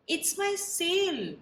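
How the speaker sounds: goat voice. Acted.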